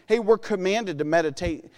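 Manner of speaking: ordinary delivery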